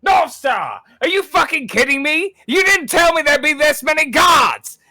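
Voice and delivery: high, loud yell across a battle; outraged shout. Acted. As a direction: yell in disbelief at an accomplice. Acted raider panicking mid-raid.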